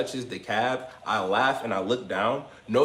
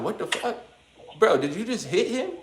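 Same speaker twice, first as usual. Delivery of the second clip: much higher, laughing disbelief; imitated shocked cousin; 8 semitones higher than usual. Cousin reacting to him punching a stranger, high laughing disbelief. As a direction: play the cousin stunned and half laughing.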